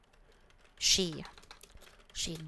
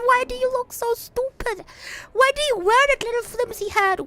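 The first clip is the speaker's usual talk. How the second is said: mocking; 11 semitones higher than usual. Mocking clown's clothes.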